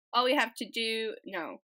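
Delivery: ordinary talk, nothing marked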